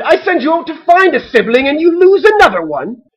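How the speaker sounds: high, loud exasperated disbelief; incredulous mother. A narrator's reading. Mother to ducklings who lost another, incredulous. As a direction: demand it like a mom at wit's end.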